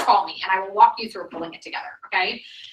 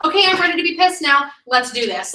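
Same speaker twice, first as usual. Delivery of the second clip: pitched up and louder, gleefully ready for a fight; mock eager to be mad; 6 semitones higher, 6 dB louder than usual. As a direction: announce you're ready to be angry like it's a treat. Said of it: "and I was like".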